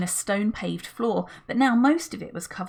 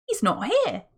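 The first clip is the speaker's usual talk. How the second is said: higher, puzzled he's not here; surprised; 6 semitones higher than usual. A narrator's reading. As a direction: react with mild surprise. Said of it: "said Julian in surprise".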